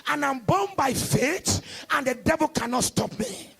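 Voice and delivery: loud, rising sermon declaration; fiery preaching. Acted. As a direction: preach it out like a revival pastor. Preaching at full force.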